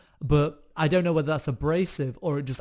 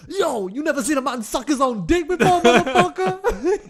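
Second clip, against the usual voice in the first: high, loud mock gangster voice; hammed-up intimidating gangster; 11 semitones higher, 7 dB louder than usual. Shown as a demonstration. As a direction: challenge them like a street tough. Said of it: "in a gangster voice".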